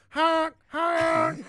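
high, loud honking shout; nervous honk. Pretend. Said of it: "I do have a nervous honk".

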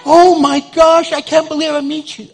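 high, slowed starstruck gush. Retold or imitated. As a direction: gush like a fan. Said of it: "She goes".

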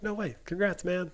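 high-pitched imitated congratulations; Dougie Fresh. Fellow mechanic congratulating him on the win, imitated amazement.

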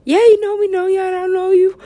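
high, loud mocking lover voice; mock lovers' dialogue. Mocking the film's lovers in a squeaky high voice. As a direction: voice the couple high and silly.

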